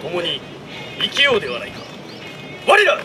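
rousing battle cry. Shown as a demonstration. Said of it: "この場合ははあで。".

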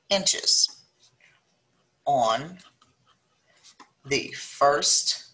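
each word spaced out with long pauses; slow dictation. Acted. Teacher dictating a word problem to students, slow and spaced.

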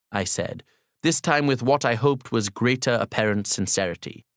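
ordinary delivery

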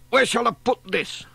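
high, loud jolly voice; cheery grocer. A narrator's reading. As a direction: call out like a breezy delivery man. Voices Sam Waldron.

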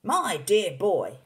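loud, slow, drawn-out exclamation; excited cry. A narrator's reading. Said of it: "cried Mister Killycranky".

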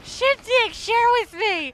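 high, very loud drawn-out shout; mock battle cry. Player calling on Shin to share, high shout. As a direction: holler it out at full volume.

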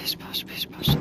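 soft whispered pish posh, slowed down; nervous whisper. Real, not acted. Player hiding from the doctor, nervous whispered pish posh. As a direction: whisper it to calm yourself.

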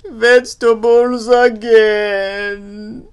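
loud, slow, drawn-out moan; tearful moan. Acted. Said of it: "he wipes away his tears and moans".

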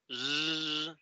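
Z sonora y alargada como zumbido; sostén la Z haciéndola vibrar; Z vibrante. Shown as a demonstration. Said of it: "como una vibración". (zeta con vibración)

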